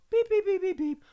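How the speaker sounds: high mimicked electronic beeps; keypad beeps. Punching in the code with high beeps. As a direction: beep like a door keypad.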